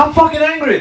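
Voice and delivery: high-pitched mock outburst; retold anger. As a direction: blurt it out, acting mad. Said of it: "I would just get mad".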